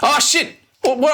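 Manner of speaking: high panicked yelp; startled by a sudden attack. Real, not acted.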